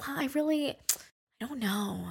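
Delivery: soft, trailing-off uncertainty; hesitant reply. Retold or imitated. Voicing a reluctant reply to breakup advice, soft and hesitant. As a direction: imitate someone backing away quietly, slowing down.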